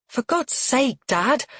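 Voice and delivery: high-pitched, exasperated snap; snapped. A narrator's reading. Daughter to her crude father at dinner, snapping. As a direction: snap at him, appalled. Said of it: "Mercedes snaps".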